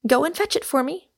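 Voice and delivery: quick, high-pitched command; crisp, sharp authority. A narrator's reading. Rosamond sending Michael for the mandolin, crisp authority. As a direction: order it briskly and sharply. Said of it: "with crisp and sharp authority".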